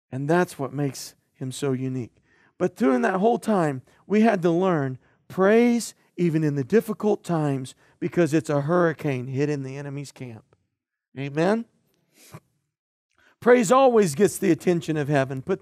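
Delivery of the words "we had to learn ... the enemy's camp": usual speaking voice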